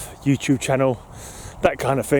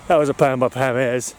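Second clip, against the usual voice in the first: ordinary talk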